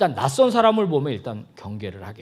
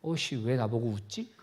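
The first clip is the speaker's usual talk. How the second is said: lower, softer puzzled inner voice; thinking to oneself; 4 semitones lower, 6 dB quieter than usual. Acted. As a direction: grumble it privately, as a thought. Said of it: "그분이 속으로".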